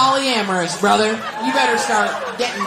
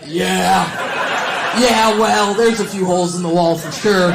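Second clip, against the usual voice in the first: mock loud, drawn-out agreement; imitating Erin; 3 semitones lower, 5 dB louder, slower than usual. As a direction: act out a partner's emphatic yeah, louder than usual. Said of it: "Erin is like".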